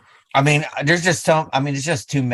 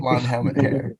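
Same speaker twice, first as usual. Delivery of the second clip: laughing. Real, not acted. Repeating the phrase, laughing.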